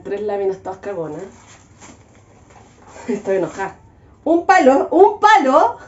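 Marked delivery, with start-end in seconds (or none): 4.2-5.8 high, loud indignant repeat of un palo. Acted. Complaining to viewers about the kit, indignant repeat. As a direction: scoff at getting just one stick. Mocking the single stick included.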